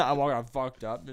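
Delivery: usual speaking voice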